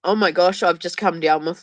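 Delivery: ordinary talk, nothing marked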